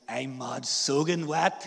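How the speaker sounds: slow, broad Northern Irish with "soaking" as "soggin"; Northern Irish voicing, k turned to g in "soaking"; exaggerated Northern Irish local speech; Northern Irish accent. Shown as a demonstration. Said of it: "we swap out that K for a G".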